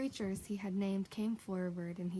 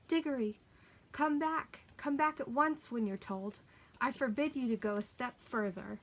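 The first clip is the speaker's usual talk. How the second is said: calling out higher and louder, but holding back; half-hearted shout; 5 semitones higher, 5 dB louder than usual. Acted. Uncle calling the boy back from afar, held-back shout. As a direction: shout after the boy without going full force. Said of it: "shouting, but by no means at the top of his voice".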